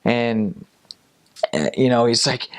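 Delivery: plain reading voice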